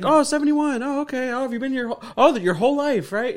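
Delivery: high-pitched, understanding interviewer impression; imitating the interviewer. Host acting out the interviewer who understands the drinker, high mock-agreeable voice. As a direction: play along as if every word made sense. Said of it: "And he's like".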